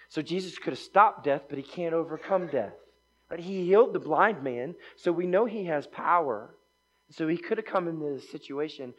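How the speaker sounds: fervent sermon emphasis; preaching. Acted. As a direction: press the point home from the pulpit. Preaching with rising force.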